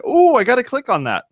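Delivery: high-pitched impulsive ooh; tempted. Acted. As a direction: jump at it like an eager clicker. Acting out a tempted site visitor.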